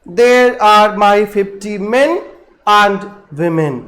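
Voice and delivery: very slow scansion, beats marked; metrical stress. Shown as a demonstration. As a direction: tap out each stressed beat slowly. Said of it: "we पर stressed हुआ है".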